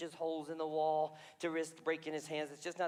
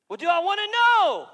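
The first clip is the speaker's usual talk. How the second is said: much higher, loud anxious question; king calling out in dread; 11 semitones higher, 14 dB louder than usual. Acted. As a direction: push Daniel, rattled. King pressing Daniel.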